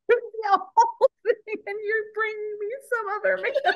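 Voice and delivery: words squeezed out between laughs; high, laughing disbelief. Real, not acted. Laughing while retelling.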